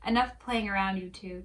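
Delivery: mild frustration, loud at the start; fed up, calling a halt; frustrated. A narrator's reading. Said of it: "Chuck says in frustration".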